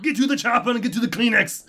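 Arnold Schwarzenegger. Retold or imitated. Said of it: "Arnold Schwarzenegger".

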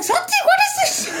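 hammed-up alarmed shout of his name; mum screaming. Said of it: "she's, like, screaming".

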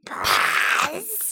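a growly gremlin take. Pretend. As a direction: say it like a grumpy gremlin. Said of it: "in a gremlin voice".

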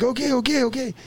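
put-on high, hasty kids' compliance; mock frantic placating. Kids to the angry lady, high placating. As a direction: imitate kids giving in under a scolding.

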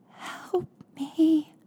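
high, thin, weak voice pleading; tiny squeaky voice. A narrator's reading. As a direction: squeak it out like a frightened victim. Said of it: "a tiny voice squeaked through the phone".

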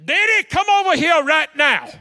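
shouting across the church, high and loud; loud call. Retold or imitated. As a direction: call him over loudly. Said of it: "Vanessa go".

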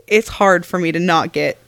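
normal talking voice

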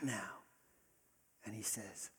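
whispered, drawing the room close; hushed whisper. Real, not acted. Pastor drawing the congregation close, hushed whisper. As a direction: lean in and whisper.

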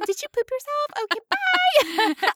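high, squeamish teasing voice; hammed-up high-pitched revulsion; mock disgust. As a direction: tease it high, drawing out the goodbye. Mock comeback, fleeing the stink.

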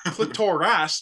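clitoris repeated as click-TOR-ass; misplaced stress. Retold or imitated. Comic mocking Siri's clitoris, wrongly stressed click-TOR-ass. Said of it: "put the emphasis in the right place".

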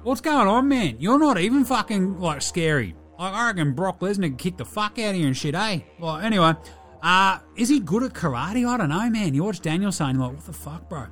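bogan character Delhi. Acted. Delhi does his pop culture review, cheeky larrikin voice. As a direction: play him like a mouthy mate down the pub.

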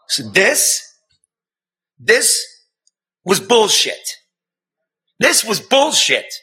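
outraged. Real, not acted. A reporter raging at the city's failure to help the widow. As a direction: spit out the outrage.

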